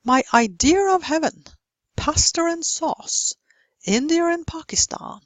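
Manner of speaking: "idea of", "pasta and", "India and" joined by an R, high; intrusive R. Shown as a demonstration. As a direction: insert R: "idear of", "pastar and", "Indiar and". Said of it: "put in an R where there is no R".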